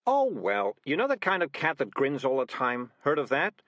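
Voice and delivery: a touch of desperate fluster; lightly flustered cat making excuses; cat covering up, mildly desperate. Acted. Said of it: "said Morris desperately".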